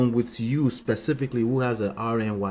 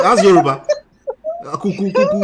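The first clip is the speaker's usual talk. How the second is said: laughing; 9 semitones higher, 9 dB louder than usual. Real, not acted.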